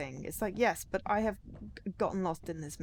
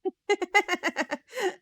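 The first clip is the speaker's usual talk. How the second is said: high laugh; 13 semitones higher, 6 dB louder than usual. Real, not acted. Laughing at own joke.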